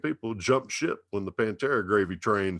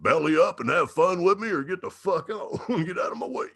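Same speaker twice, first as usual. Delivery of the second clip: higher, hammed-up defiant voice with a laugh; defiant rebel shout; 9 semitones higher, 3 dB louder than usual. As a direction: throw it out like a cocky rebel telling them to get lost. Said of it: "It was like".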